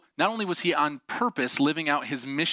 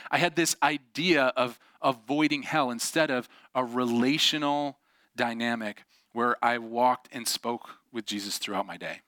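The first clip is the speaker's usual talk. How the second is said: normal talking voice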